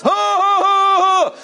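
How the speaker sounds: high, loud, slow hammed-up cackle; maniacal laugh. Preacher acts the fat guy laughing hysterically at the offering plate. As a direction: cackle like a madman tossing cash. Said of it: "one of those maniacal laughs".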